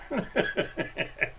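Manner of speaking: soft breathy nervous giggle; nervous laugh. Real, not acted. Nervous about tagging Grim, a soft breathy laugh. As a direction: laugh under your breath, a little scared. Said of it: "I'm laughing 'cause I'm kinda scared".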